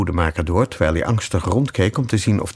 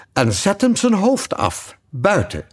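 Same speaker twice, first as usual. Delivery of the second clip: queen's order; 11 semitones higher than usual. Acted.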